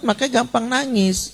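usual speaking voice

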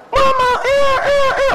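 put-on high shouted little child's voice; high shouting kid voice. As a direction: call out like a small child. Mimics child calling mama.

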